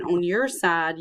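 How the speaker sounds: ordinary talk, nothing marked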